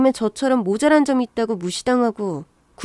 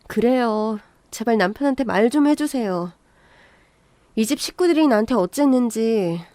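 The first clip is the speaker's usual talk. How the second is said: plain reading voice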